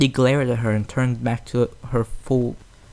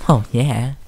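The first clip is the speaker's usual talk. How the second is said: sulky mutter; 5 dB louder than usual. A narrator's reading. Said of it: "she muttered".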